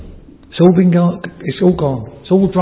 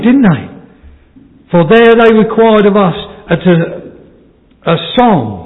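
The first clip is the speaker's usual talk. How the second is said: raised pulpit voice, higher toward the end; preaching exhortation; 4 semitones higher, 5 dB louder than usual. Acted. Preacher urges friends to take the harp again, preaching exhortation. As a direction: urge the listeners on with force.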